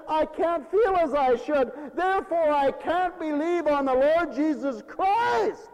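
high, loud, overdone wail of an excuse; mock lament. Another caller tells pastor they can't believe, mock wailing. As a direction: stretch out the complaint in a mocking high voice.

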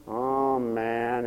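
low, soft, weary mock complaint; bored. Tom facing an endless fence, acted boredom. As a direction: sigh it out as a bored boy, low and subdued. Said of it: "so bored with the whole thing".

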